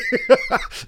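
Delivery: a sudden high squeal of a laugh; high burst of laughter. Real, not acted. Pastor laughing at his own no-win story. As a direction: laugh out at the absurd bind.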